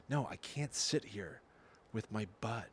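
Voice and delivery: hushed, slowed aside in retelling; imitating a discreet, coded refusal; speaking in code. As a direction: drop it low and soft, confidential. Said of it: "speaking in code to him".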